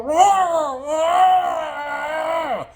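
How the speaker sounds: long, high wail of pain; high sustained "raaah"; imitated scream. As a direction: mimic a bitten diver screaming underwater. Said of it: "you could hear the guy".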